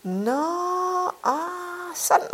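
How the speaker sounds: drawn-out, rising "ah" as it clicks; dawning realisation. A narrator's reading. Husband finally understands what his wife means, brightening. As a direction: let the penny drop slowly. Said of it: "Derpå lyste hans ansigt op".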